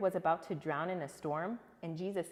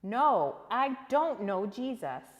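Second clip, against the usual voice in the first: loud, higher desperate denial; Peter's third denial; 5 semitones higher, 9 dB louder, slower than usual. Acted. Peter denying Jesus a third time, loud and higher. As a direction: shout it, panicking. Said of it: "third denial".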